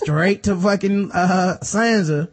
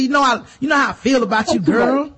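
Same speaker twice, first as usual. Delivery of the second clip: mock shout; 6 semitones higher than usual. Littlefinger shouts at Lysa.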